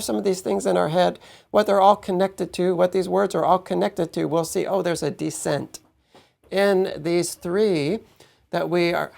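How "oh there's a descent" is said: usual speaking voice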